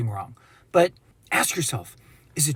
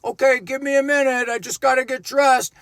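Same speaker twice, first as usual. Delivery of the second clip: higher, casual voice behind a door; normal person's voice; 5 semitones higher than usual. Retold or imitated. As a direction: sound innocent and ordinary. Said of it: "a normal person's voice responds".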